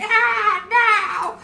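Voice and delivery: very high, drawn-out wailing scream; thin puppet shriek, held long. Acted. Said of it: "you just hear them screaming".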